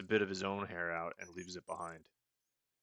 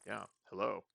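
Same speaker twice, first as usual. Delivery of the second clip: ordinary talk, nothing marked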